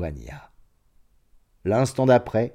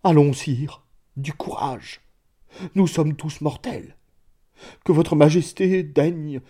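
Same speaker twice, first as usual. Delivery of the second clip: voix hoquetante; 6 semitones higher than usual. A narrator's reading. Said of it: "d'une voix hoquetante".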